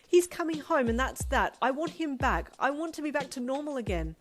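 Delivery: high, loud insistence; insist, near tears; angry tearful insistence. A narrator's reading. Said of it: "angry tears falling".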